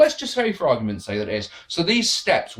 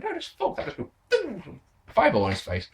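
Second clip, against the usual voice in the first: much higher, softer, exasperated; exasperated mock; 7 semitones higher, 4 dB quieter than usual. Real, not acted. Reviewer mocking Kane's fireball logic, high exasperated scoff. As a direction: toss it off in disbelief.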